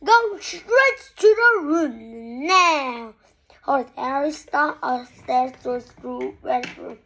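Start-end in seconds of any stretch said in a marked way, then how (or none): 0.0-3.1 high, loud, drawn-out order; furious parent banishing a child; shouted command. A narrator's reading. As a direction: yell it slowly, stretching the words. Parent sending child away after fight.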